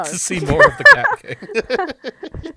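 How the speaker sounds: mock-swooning squeal over the Cat King; teasing squeal. Teasing Grace about Cat King.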